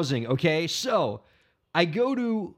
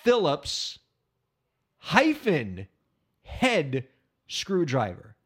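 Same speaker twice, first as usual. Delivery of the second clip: Phillips, hyphen, head, screwdriver, each word set apart by pauses; word by word reveal; much slower than usual. Shown as a demonstration.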